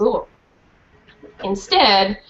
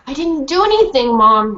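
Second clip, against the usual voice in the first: protesting; 4 semitones higher, 4 dB louder than usual. Acted. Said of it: "Rennie protested".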